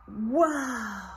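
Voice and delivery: usual speaking voice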